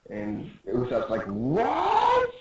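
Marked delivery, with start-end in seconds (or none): 1.3-2.3 rising high acted cry; shocked. Usopp told they're in danger, high shocked cry. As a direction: swoop up in disbelief.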